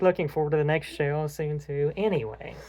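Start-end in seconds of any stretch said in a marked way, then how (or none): none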